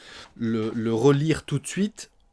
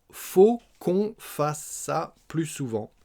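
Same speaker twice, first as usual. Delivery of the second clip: slow, higher, word by word; slow model repetition; 4 semitones higher, slower than usual. Shown as a demonstration. Teacher repeating Eric's answer for learners, slow and separated. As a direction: draw out "faut qu'on fasse ça" syllable by syllable.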